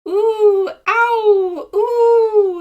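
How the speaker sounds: very high, loud yelps of pain; sharp pained cries, ooh and ow. A narrator's reading. Seagull stepping on sea urchin spines, high loud pained yelps. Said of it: "he cried".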